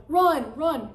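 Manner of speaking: high, loud getaway cry; re-enacted panicky command; urgent shout. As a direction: yell for a friend to flee. Re-enacts fleeing the cop.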